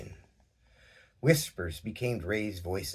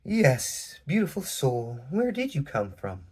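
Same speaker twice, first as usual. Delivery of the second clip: higher, gentle and resonant woman's voice; soft resonant Crystal; 5 semitones higher, 3 dB louder than usual. A narrator's reading. Crystal welcoming the stranger, gentle and resonant. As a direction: soothe the stranger kindly. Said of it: "The soft, resonant voice of Crystal Quartz".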